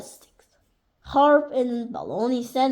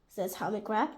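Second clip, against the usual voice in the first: plain reading voice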